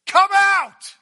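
huge, high, drawn-out shout; loud calling out. Retold or imitated. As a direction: summon someone from afar, booming command. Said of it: "called out with a loud voice".

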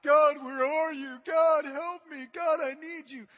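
slow, high, loud plea to God; crying out to God. Acted. A churchgoer crying out to a silent God. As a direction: stretch out a desperate cry to heaven. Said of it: "You cry out to God".

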